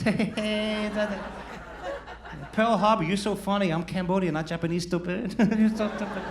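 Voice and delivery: high, loud jeering put-on voice; mock taunting voice. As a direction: tease him in a squeaky mocking voice. Voicing a taunting character.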